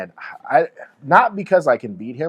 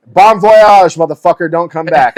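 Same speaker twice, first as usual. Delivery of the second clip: high, loud mock farewell; mock send-off; 4 semitones higher, 8 dB louder, slower than usual. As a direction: wave them off loudly and higher.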